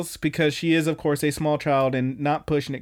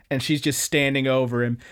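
ordinary delivery

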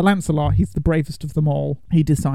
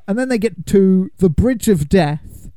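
usual speaking voice

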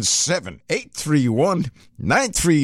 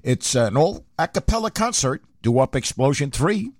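ordinary talk, nothing marked

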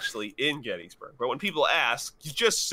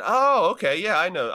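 higher, louder eager recognition; eager reply; 5 semitones higher, 5 dB louder than usual. Retold or imitated. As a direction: imitate an excited stranger. Retold stranger's eager reply.

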